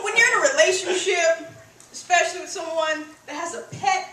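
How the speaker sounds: ordinary delivery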